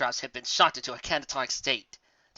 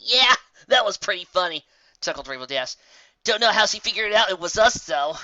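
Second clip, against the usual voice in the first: higher brash tomboy pony; 4 semitones higher, 6 dB louder than usual. Acted. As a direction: coax a grumpy friend with a grin. Rainbow Dash character voice.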